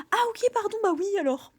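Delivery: high, loud sheepish apology; embarrassed apology. Retold or imitated. A friend realising the hug was only friendly, high sheepish apology. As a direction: gush an awkward sorry.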